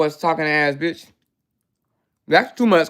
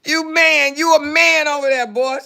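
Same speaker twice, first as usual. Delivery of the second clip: mock rival voice; 9 semitones higher than usual. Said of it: "She said".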